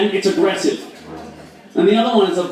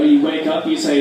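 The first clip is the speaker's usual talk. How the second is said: ordinary talk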